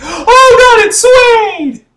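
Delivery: gasp, then a very high, loud shriek; hammed-up horror at the reveal; mock shock.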